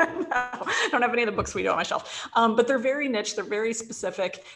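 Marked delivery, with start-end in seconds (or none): 0.0-2.3 laughing through the words, quick; chuckling as he admits it. Real, not acted. Guest laughing at his own empty shelf, quick laughing words.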